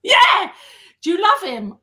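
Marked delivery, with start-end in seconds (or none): 0.0-0.5 loud, high burst of "Yeah!"; excited yell. Real, not acted. Fan hearing her idol named, excited shout. Excited reply about favourite musician.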